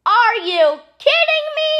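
high, outraged disbelief; can't believe the mess; a high cry of disbelief. Acted. Outraged at grape juice.

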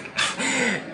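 sudden high laugh in disbelief; burst of laughter. Real, not acted. Laughing at a clip.

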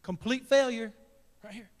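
loud blurted self-verdict; inner despair. Retold or imitated. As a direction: blurt it out as a loud judgment on yourself. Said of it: "you think to yourself".

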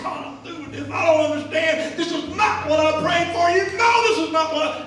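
high, loud yelling at God; screaming tantrum. Retold or imitated. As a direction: scream your frustration, building to full volume. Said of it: "throwing stuff 'cause I'm screaming".

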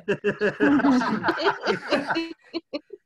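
a long, loud laugh. Real, not acted. Laughing at his own joke about the water, long loud laugh.

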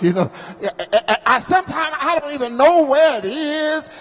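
sermon cadence, rising and intense; preaching. Acted. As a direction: lift the scripture like a preacher at the pulpit. Preaching at full force.

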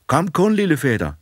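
waving and calling to the coffin. A narrator's reading. Boy hails the dead cousin, calling out high and loud. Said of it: "vinke og råbe".

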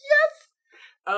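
sudden high, loud yes; excited cheer. Real, not acted. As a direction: cheer like you just won. Cheering his own shield summon.

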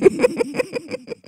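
very high wheezy laugh; helpless laughter. Real, not acted. Speaker terrified by their own bass, helpless laughter.

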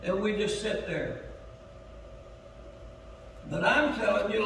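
ordinary talk

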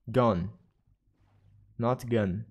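"Gone" said slowly with a long open aw, then "gun" with a short uh; pronunciation of gone. Shown as a demonstration. Streamer corrects their own English, saying "Gone" slowly, not "gun". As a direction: stretch "Gone" and set it against "gun".

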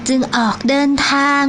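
ordinary delivery

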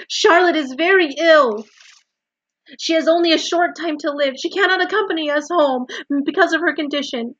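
high, loud, pleading; urgent cry. A narrator's reading. Wilbur begs Templeton to save the egg sac, crying out. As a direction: plead frantically at the top of your voice. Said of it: "cried Wilbur".

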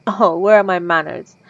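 normal talking voice

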